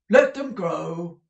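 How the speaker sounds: high, loud theatrical manager voice; booming stagey manager asking; Mr Crummles the manager. A narrator's reading. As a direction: play the bluff old actor-manager.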